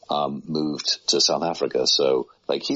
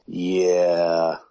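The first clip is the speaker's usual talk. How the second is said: long, drawn-out doubtful yeah; mock-hesitant stalling; reluctant hedge; 3 dB louder than usual. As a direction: stretch the word out as you hesitate to take it on. Acting out his reluctance at offers.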